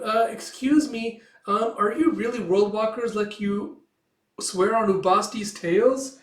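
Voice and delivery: high, slow, tentative questioner; hesitant young admirer. Acted. Young cat asking the world walkers if they are real, hesitant and high.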